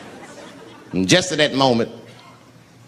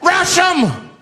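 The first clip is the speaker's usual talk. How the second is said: rallying shout. Acted. Rallying the bus.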